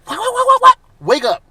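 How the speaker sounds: high, loud rapid-fire acted burst; hyped-up outburst. Fan acting out how the movie might wow him, hyped up. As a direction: play it as a sudden hammed-up wake-up call.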